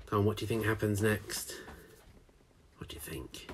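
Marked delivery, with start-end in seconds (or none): 2.8-3.5 unvoiced hushed question; whisper. Real, not acted. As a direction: whisper it secretly.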